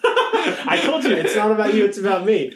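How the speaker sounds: high, loud laughing protest. Real, not acted.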